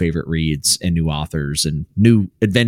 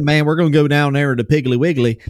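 put-on West Virginia accent; 7 semitones higher, 4 dB louder than usual. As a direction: mimic the folks back home in West Virginia. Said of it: "that's how they talk".

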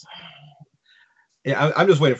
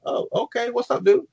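high, uncertain mock reply; acting out a startled, higher-pitched response; taken aback; 12 semitones higher than usual. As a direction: answer as if caught off guard. Startled reply to yelling villager.

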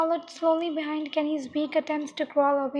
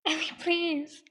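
sobbing plea; 2 semitones higher, slower than usual. Acted. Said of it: "he sobbed".

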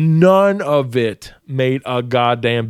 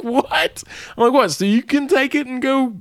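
high, disbelieving what?; can't believe what he's hearing; incredulous; 10 semitones higher than usual. Retold or imitated. Telling the boss off, high and incredulous. His own retold disbelief.

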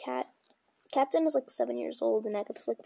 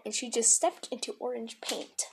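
ordinary delivery

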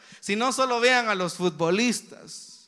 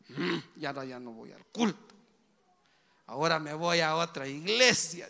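grumbling; 5 semitones lower, 4 dB quieter than usual. Retold or imitated. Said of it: "refunfuñando".

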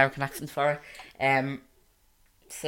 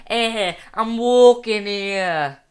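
loud, high, drawn-out Brooklyn accent; hammed-up New York accent; 6 semitones higher, 10 dB louder, much slower than usual. Shown as a demonstration. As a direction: holler it like a Brooklyn pedestrian. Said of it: "a New York accent".

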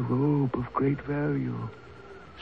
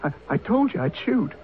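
shaken stammer; 10 semitones higher than usual. Acted. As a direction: stammer in shock after firing. Carter stammers after firing the shot.

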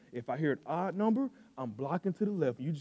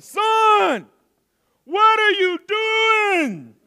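high, loud, slow drawn shout; coach yell; 12 dB louder, much slower than usual. Retold or imitated. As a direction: bellow at the kid from the sideline. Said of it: "yell something like".

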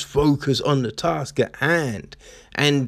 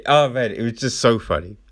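normal talking voice

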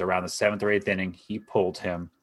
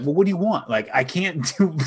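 high, loud, mock-exasperated player pleading; hammed-up whine of a ballplayer who can't win; 9 semitones higher, 4 dB louder than usual. Player answering critics of his English, in a high exasperated voice.

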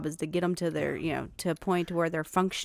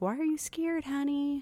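put-on high, gentle question to a child; gentle parent; 10 semitones higher than usual. Dad retelling comforting his scared son, high and gentle. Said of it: "and I'm like".

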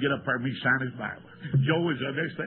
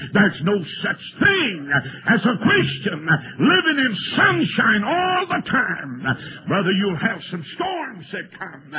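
full-force preaching; 2 semitones higher, 4 dB louder, slower than usual. Acted. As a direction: preach it to the rafters, near tears.

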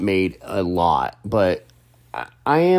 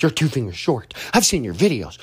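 lightly acted starstruck gushing; fangirling; 5 semitones higher than usual. Fan recognizing him by his hand, fangirling. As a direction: imitate an excited fan, pitched higher. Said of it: "she started, like, fangirling".

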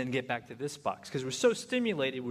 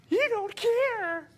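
very high, slow, louder accusation; hammed-up furious wife; 7 dB louder, much slower than usual. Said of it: "she gets furious".

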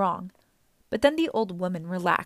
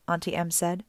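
ordinary delivery, nothing marked

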